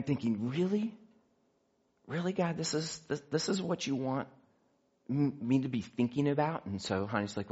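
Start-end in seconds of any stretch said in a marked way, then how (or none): 0.5-6.6 incredulous questioning. Retold or imitated. Incredulous question put to God.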